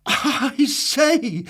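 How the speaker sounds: laughed. Acted. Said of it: "laughed Mister Happy".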